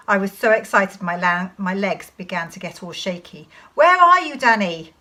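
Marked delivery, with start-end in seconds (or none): 3.7-4.9 father's high, loud searching call. Acted. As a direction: shout high, falling on the name. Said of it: "my father called out".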